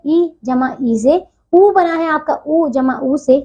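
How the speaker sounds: slow, deliberate spelling out of a vowel sum; slow explaining. Shown as a demonstration. Teacher to her pupils, slowly spelling out vowel sums. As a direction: lay each sound out slowly for the children. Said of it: "teacher spells out vowel sums".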